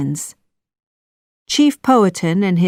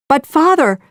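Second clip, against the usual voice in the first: high, loud plea; cry out; 8 semitones higher, 4 dB louder than usual. A narrator's reading. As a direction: cry out to him, high and pleading. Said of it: "cried Pocahontas".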